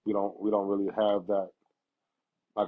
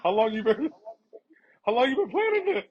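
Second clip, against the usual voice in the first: high, laughing retold question; half-laughing as if asking your dad again; laughing disbelief; 10 semitones higher, 3 dB louder than usual. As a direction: ask it through laughter in disbelief. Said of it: "I was like".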